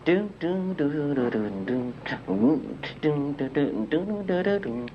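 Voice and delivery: beatboxed synth bass line; doom doo vocal bass riff; Moog bass imitation. Shown as a demonstration. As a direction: mouth a bouncy Moog bassline. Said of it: "I was imitating a Moog bass".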